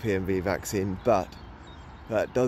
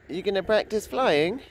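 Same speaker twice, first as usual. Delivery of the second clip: high pet talk; 8 semitones higher than usual. Real, not acted. Baby talk to pet pigeon.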